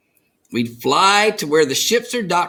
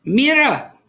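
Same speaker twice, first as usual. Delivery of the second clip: higher, louder call; 10 semitones higher, 6 dB louder than usual. A narrator's reading. Abuela calling Rosa to look, higher and louder. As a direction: call her attention.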